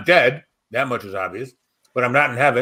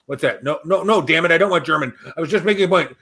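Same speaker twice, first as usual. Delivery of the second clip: usual speaking voice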